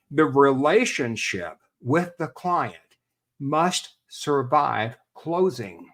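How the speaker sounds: each word laid down slowly and deliberately; drawn out, stressing must survive closing; slow emphatic rule. Real, not acted. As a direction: spell out the rule word by word for weight. States his key rule slowly.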